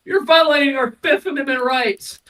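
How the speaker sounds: high, hammed-up indignant Italian protest; mock Italian. Freight cars claiming their rights, mock Italian protest. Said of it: "Imagine the freight cars were Italians".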